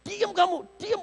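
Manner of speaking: ditahan keras, nada tinggi, membentak diam; hushing crowd. Acted. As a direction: bentak orang supaya diam. (suara orang banyak membentak diam)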